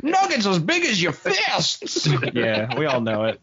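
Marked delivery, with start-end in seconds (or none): 0.0-2.1 high, slow grizzled prospector; old prospector. Acted. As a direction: holler about gold like an old miner. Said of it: "a nineteenth century prospector".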